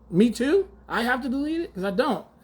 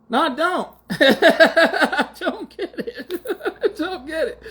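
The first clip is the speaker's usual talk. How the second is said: laughing disbelief; 10 semitones higher, 4 dB louder, slower than usual. Real, not acted. Incredulous reply to the excuse.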